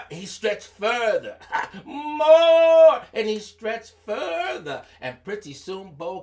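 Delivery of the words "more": high, loud drawn-out call; calling more. Acted. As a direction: urge further. Urging the snake on.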